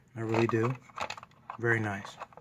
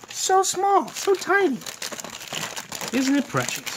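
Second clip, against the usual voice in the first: high-pitched cutesy squeal; squealing excitement. Real, not acted. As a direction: squeal over something tiny and cute. Cooing over tiny controller.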